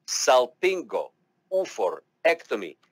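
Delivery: Salpingo-oophorectomy drawn out slowly, every syllable separate. Shown as a demonstration. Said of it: "slowly but surely".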